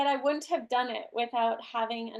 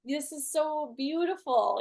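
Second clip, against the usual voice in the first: higher, slow and adoring; adoring boyfriend; 4 semitones higher than usual. Retold or imitated. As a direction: gush over her tears. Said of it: "he was like".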